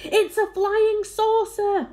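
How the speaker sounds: high, loud, drawn-out cry of discovery; excited crowd shout. A narrator's reading. Crowd shouting at a strange sight in the sky, excited shout. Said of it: "they shouted".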